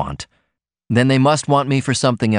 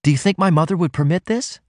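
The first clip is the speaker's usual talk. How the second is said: pleading; 7 semitones higher, faster than usual. A narrator's reading. Ruth pleads with Martin.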